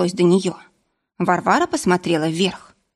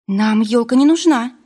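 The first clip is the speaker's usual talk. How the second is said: higher, protesting self-justification; defensive excuse; 4 semitones higher, 4 dB louder than usual. A narrator's reading. Варвара justifies herself to the forester, defensive. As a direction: protest your innocence, pitch lifted. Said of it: "стала оправдываться Варвара".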